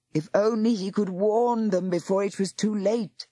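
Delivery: usual speaking voice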